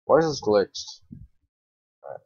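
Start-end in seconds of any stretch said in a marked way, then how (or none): none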